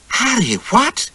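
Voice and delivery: high, slow, astonished gasp of a question; astonished wife. Acted. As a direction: stare at the medal in disbelief. Wife sees the medal.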